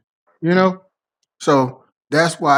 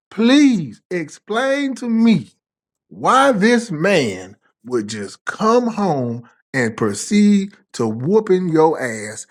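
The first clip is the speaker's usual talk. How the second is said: slow, drawn-out questioning; 5 semitones higher, much slower than usual. Retold or imitated. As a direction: stretch every word out, disbelieving. Retold confronting his girlfriend.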